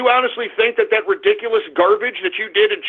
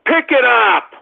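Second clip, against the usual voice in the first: exasperated call; 3 semitones higher, 3 dB louder, slower than usual. Pretend. Yelling at an unanswered call.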